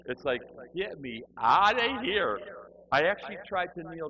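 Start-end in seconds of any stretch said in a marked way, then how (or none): 0.8-2.4 loud, high, drawn-out mock desperation; desperate plea. Newcomer begging God to free him from church, desperately. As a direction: wail it like you must escape.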